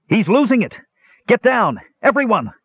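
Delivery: very high, urgent cry of alarm; called-out warning. A narrator's reading. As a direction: call out a warning to the whole room. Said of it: "Tannen called out".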